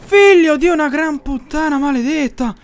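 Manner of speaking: loud, high exasperated outburst; angry cursing. Real, not acted. Gamer cursing his misfired bottle, loud and exasperated. Cursing after the botched firebomb.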